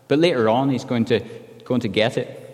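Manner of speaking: ordinary talk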